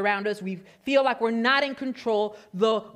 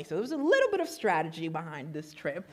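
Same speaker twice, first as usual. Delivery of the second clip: ordinary delivery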